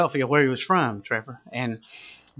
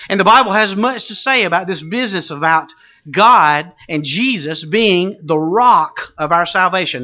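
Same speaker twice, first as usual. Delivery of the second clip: loud, raised preacher's proclamation; full-force preaching; 6 semitones higher, 6 dB louder than usual. Real, not acted. Preacher proclaiming faith in the rock, full-force preaching. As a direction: drive it home from the pulpit, leaning on key words.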